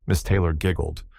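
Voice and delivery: ordinary delivery, nothing marked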